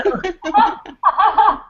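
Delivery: high, breathy giggle; real high-pitched laugh. Real, not acted. Amused about being in her robe.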